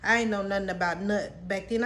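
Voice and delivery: ordinary talk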